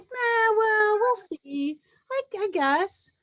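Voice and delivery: high, hedging meh; downplay it, reluctant to commit; noncommittal shrug. Retold or imitated. Imitating someone hedging about their new job. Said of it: "and you go".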